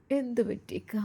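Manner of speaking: soft whispered question; hushed worried whisper. Acted. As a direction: whisper it to her husband beside her. Wife asks husband at night.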